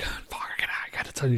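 hushed unvoiced whisper to a friend. Whispering to his buddy beside the rock star's family. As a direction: whisper it so the family won't hear.

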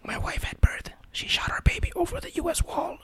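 soft joke whisper. Pretend. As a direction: whisper an absurd headline. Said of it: "Whisper Theater".

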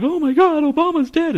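very high, breathless fake shock; mock gasp of grief. Mocking people who gasp that Obama is dead, in a very high voice. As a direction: gush like someone hammering out fake sorrow. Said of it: "people are just like".

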